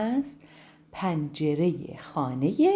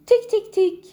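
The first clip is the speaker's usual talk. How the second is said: high, loud tik tik tik like a beak on wood; sharp imitated pecking; knocking sound; 10 dB louder than usual. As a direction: tap it out like a woodpecker.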